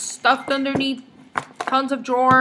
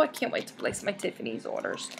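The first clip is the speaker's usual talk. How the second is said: excited whisper, secret; 4 semitones lower, 12 dB quieter, faster than usual. Real, not acted.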